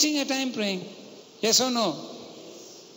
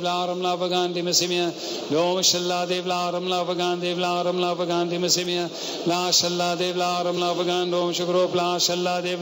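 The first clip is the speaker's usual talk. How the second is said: steady rhythmic chant of tongues; praying in tongues; 3 semitones lower than usual. Real, not acted. Preacher leads congregation in tongues, chanting. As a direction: pour it out as a flowing prayer language. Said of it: "pray in tongues".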